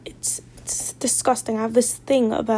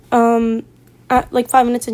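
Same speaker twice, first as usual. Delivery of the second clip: ordinary delivery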